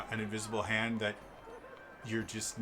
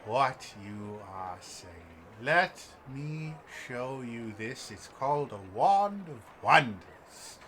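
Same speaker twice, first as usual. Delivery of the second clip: theatrical elf shopkeeper; 5 dB louder than usual. Acted. Elf pitches the Wand of Wonders, grandly theatrical. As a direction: build up the wonder like a showman. Game master voices wand seller.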